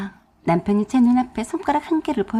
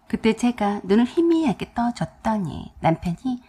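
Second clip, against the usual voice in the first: plain reading voice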